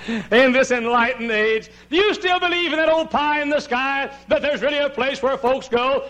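high, loud scoffing questioner; mock doubter. Preacher voicing a doubter's challenge, high and loud. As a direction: ape a sceptic's challenge. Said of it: "Oh, but you say".

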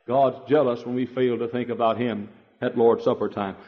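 ordinary delivery

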